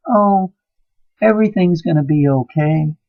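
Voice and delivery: high, sing-song mock of a soothing man; mocking a soft gentle man. Preacher mocks the gentle men women want, high and sneering. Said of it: "they want men that go".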